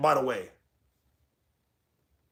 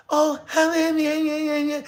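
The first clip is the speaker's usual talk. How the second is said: high, softer mimicked voice; mock her real voice, pitched way up; 7 semitones higher, 4 dB quieter than usual. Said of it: "her real voice came out".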